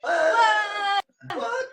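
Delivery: very high squeal of surprise; shocked squeal. Real, not acted. Co-host shown his first tattoo, astonished high squeal. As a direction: squeal it in disbelief.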